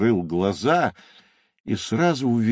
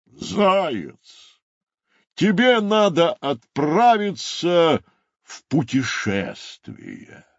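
rhinoceros proclaiming; 8 semitones higher, slower than usual. Acted. Rhinoceros urges the hare to travel, grand proclamation. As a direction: proclaim it, then slow at the end.

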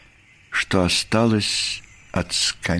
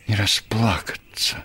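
plain reading voice

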